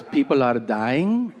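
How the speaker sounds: put-on slow Peruvian-accented mom; mother's Peruvian accent. Mom reporting on fires, slow Peruvian accent. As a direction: imitate a Peruvian mother, drawing the words out. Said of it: "she's from South America".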